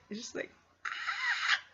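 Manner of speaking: high drawn-out shriek of disgust; slow high imitated scream; mock shriek. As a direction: shriek like a bug just landed on you. Feeling crickets hopping on foot.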